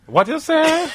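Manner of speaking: high, startled voice; high caller voice. Pretend. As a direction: squeak it out in surprise. Acting out the caller.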